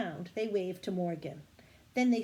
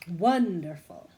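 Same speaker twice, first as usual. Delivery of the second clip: pleased; 9 dB louder, slower than usual. Acted. Morgan pleased.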